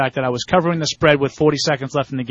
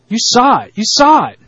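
mock Costner, louder; 8 semitones higher, 8 dB louder than usual. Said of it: "my Kevin Costner".

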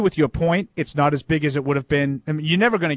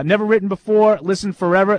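louder dramatic reread; 6 semitones higher, 6 dB louder than usual. Shown as a demonstration. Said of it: "That was awesome".

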